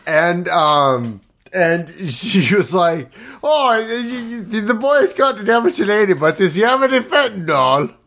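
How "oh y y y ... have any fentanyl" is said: put-on Irish nun's voice, halting start. Improviser voicing the nun Miss O'Malley, hammed-up Irish accent. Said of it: "this accent you're doing is offensive".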